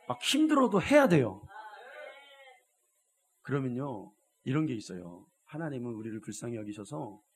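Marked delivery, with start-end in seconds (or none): 0.1-1.4 higher and louder, urging; shouted exhortation from the pulpit; fiery exhortation. Real, not acted. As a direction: push the congregation hard. Preaching at full force.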